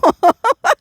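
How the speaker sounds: high, loud laugh; bright amused laugh at her own line; burst of laughter. Real, not acted.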